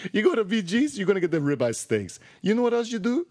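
high, singsong mock Italian; hammed-up Italian pitchman; Italian accent. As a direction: sell it in a put-on Italian accent. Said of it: "That was my Italian accent".

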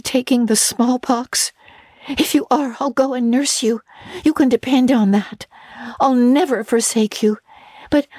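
put-on a breathless young girl confiding; Anne's girlish voice. Girl confiding a mortifying dinner to her friend, eager. Anne pleading with Diana.

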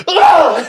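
a loud, high startled "Ah!"; uncle's startled yell. Retold or imitated. Uncle Jim yelping as the dog flings urine at him. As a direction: yelp as if hit in the face with spray. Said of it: "And Uncle Jim goes".

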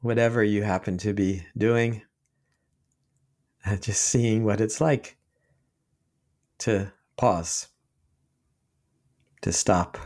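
unhurried meditation guide with long pauses; guided meditation. Real, not acted. Teacher leading listeners in sitting practice right now, calm guided meditation. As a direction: ease listeners into noticing, leaving long silences between short phrases.

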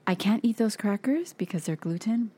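ordinary delivery

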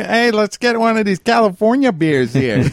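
high, brash beer-bro voice; Chicago guy. Pretend. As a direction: play the eager Chicago drinker. Voicing Chicago drinkers.

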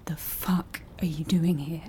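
soft, breathless disbelief; shocked whisper. Acted. Woman stunned to find her old friend at her lighthouse, whispering. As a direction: hiss it out, can't believe it.

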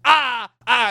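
high loud wailing ahs; mock anguished scream. Other driver remembering the ending, loud mock wail. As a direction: let out a hammed-up howl of pain. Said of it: "and then he starts screaming".

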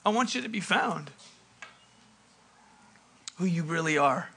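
soft, low, heartfelt appeal; quietly voiced fatherly longing; tender father. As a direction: drop to a hushed, loving plea. Father tenderly to the lost son.

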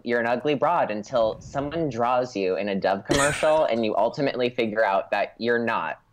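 normal talking voice

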